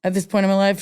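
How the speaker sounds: ordinary delivery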